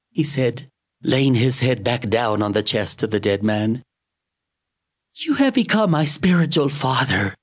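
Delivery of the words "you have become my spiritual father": sobbing. Acted. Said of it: "He sobbed again".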